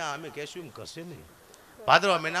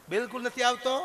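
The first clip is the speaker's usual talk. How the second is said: mock surprised doctor; 7 semitones higher, 5 dB louder than usual.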